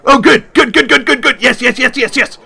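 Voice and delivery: high, rapid-fire stream of repeats; eager relief. Acted. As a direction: gush with nervous gratitude. Relieved someone will go along.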